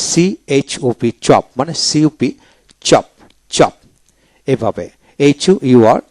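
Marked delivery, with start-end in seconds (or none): none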